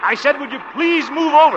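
loud and high, spelling it out. Acted. Repeating to a deaf farmer.

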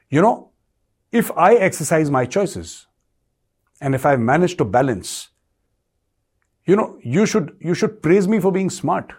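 lightly voiced diplomat teasing a friend; quoting Jaishankar. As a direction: say it slowly with a sly grin. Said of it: "Doctor Jaishankar said".

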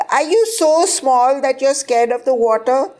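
high, mocking child voice; lightly voiced taunting child; teasing little cousin. A narrator's reading. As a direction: tease like a small kid. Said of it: "asked if I was so small".

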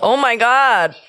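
high, tearful, real crying. Real, not acted. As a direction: let the tears take over. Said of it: "I think I'm crying harder".

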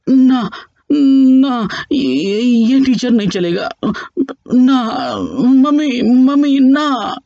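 high, loud desperate protest; inner scream. Acted. Son's mind screaming against mom as his teacher, loud wail. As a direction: cry out no, no, mummy, no. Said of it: "चीख चीख के कह रहा था".

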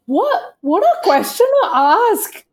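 can't believe she asked that; indignant disbelief. Real, not acted. Indignant at cohost's period question.

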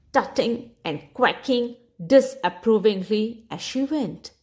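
usual speaking voice

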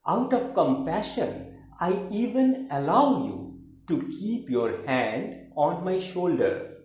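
emphatic declaimed verse with high phrase openings; theatrical poem reading with swooping intonation; poetry recitation. Acted. Recites third poem, In Return.

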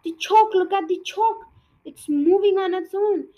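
high, loud panicked yelling; frantic schoolboy scream; hysterical scream. A narrator's reading. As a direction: shriek it out like a terrified boy. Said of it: "So hysterical and sure was Nigel's scream".

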